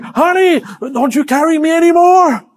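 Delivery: imitating the wife. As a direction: play the wife begging to be carried. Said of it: "the wife is saying".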